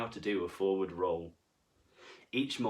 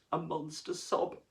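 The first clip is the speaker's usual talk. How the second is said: mock sob; 4 semitones higher than usual. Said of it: "The monster sobbed".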